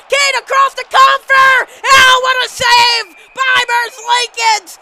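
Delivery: high, very loud hockey call peaking on the save; excited play-by-play shout. Real, not acted. Announcer calling a rush and big save, shouting excitedly.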